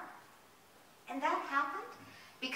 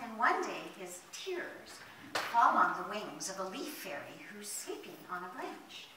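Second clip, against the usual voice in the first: ordinary delivery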